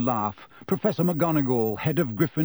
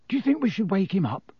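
quick, high, unsure question; awkward, hesitant suggestion; 7 semitones higher, faster than usual. A narrator's reading. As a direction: ask awkwardly, in a hurry. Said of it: "Ron asked awkwardly".

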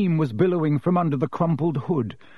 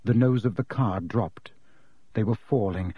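ordinary delivery, nothing marked

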